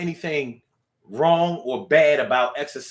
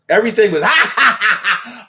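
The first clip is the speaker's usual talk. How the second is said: ordinary talk, nothing marked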